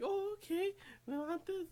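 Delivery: very high put-on girl voice; high-pitched mimicry. Girl answering the panties offer, high mimicry. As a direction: pitch it way up, imitating her. Voicing the girl's reply in retelling.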